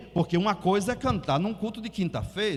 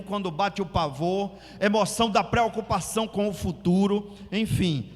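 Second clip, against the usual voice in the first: ordinary delivery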